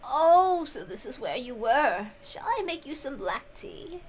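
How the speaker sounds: a hint of a doting elderly servant offering tea; kindly old servant. Servant Kumasawa finds the young mistress, lightly voiced and gentle.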